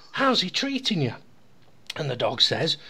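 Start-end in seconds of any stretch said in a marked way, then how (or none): 0.1-1.1 higher-pitched friendly question; lightly voiced, lifted inquiry; joke's Englishman. Englishman asks the dog about its owner, lightly raised voice.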